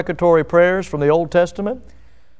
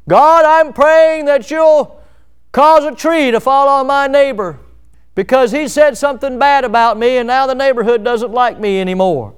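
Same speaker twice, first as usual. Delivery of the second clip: mock vengeful prayer; 6 semitones higher, 4 dB louder than usual. Preacher mimics a churchgoer praying for a neighbor's harm, high and loud. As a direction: pray for revenge, hammed up.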